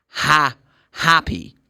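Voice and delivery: slow "ha, happy" with British A; British vowel. Shown as a demonstration. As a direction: lengthen "ha", then "happy", British-style. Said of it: "if it's British English".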